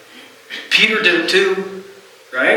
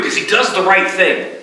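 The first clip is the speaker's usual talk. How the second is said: ordinary talk, nothing marked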